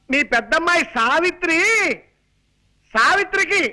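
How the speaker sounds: high-pitched calling to someone hard of hearing; strong, projected voice. Acted. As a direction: spell out the news at full projection. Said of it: "మామూలుగా చెప్పొచ్చు కదా".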